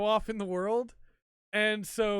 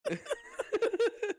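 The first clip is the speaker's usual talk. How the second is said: high giggling burst of laughter; real laughter. Real, not acted. Laughing at his own retelling of the comedy scene, high real laughter.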